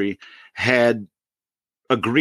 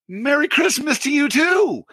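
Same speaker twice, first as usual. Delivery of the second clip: mock cheery retort. Caller holding the phone after the hang-up, high mock holler. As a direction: call it out high into a dead phone.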